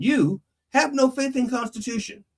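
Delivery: high, level-pitched order; as a command. Shown as a demonstration. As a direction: issue it as an instruction, pitched well up. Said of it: "that could actually be a command".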